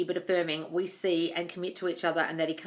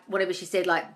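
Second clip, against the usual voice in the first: usual speaking voice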